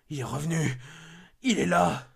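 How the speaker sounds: the danger is back, sound it; terrified alarm. Acted. The killer has returned.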